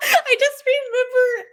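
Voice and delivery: high, loud, slow through laughter; laughing hard at the memory. Real, not acted. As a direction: talk through a burst of laughter. Laughing at her own story.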